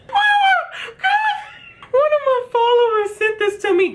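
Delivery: talking through high, loud laughter; giggling high and loud while telling the viewers; laughing. Real, not acted. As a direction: squeal the words out while cracking up. Laughing at a follower's video.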